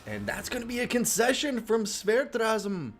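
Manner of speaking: high excited caster call; excited shout. Real, not acted. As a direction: call the result with a big shout. Excited cast call of result.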